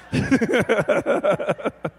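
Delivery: high, rapid burst of laughter; real laugh. Real, not acted. Pastor after joking about his burgers, high real laughter. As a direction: crack up at your own joke.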